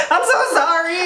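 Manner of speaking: high, hammed-up tearful apology; mock crying. Lover after a fight, playfully crying an apology to be heard. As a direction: laugh through a fake weepy sorry. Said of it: "I'm just gonna cry louder".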